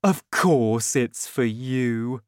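slow, coaxing father voice; slowly, sugary sweet; sweet, smiling. A narrator's reading. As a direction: coax her with a smile. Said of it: "he smiled sweetly".

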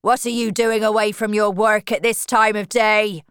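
loud, scolding farmer; angry shout. A narrator's reading. Farmer shouting at worker away from her work, angry shout. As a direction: shout it across at a slacking worker. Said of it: "he shouted at Tess".

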